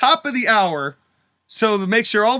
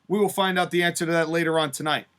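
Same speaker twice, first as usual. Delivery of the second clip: ordinary talk, nothing marked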